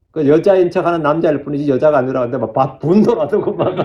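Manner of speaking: breaking into laughter while retelling; amused, laughing. Real, not acted. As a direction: retell it, cracking up toward the end. Amused retelling of heated debate. (웃음이 터지며 전하는 말투)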